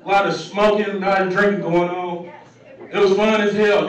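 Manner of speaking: usual speaking voice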